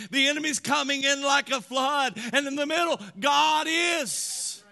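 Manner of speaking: high, impassioned preacher's voice; full-force preaching. Real, not acted. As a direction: preach it high and forceful, driving each point home.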